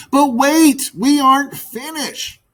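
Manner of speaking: high, urgent call after the birds; frustrated cry. Acted. Magpie calling back her departing class, frustrated. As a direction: call them back in exasperation. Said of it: "cried the frustrated magpie".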